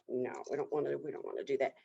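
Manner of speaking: low, soft private aside; hushed inner voice, backing off; thinking to himself. A narrator's reading. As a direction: drop down low as if talking to yourself. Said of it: "thinks to himself".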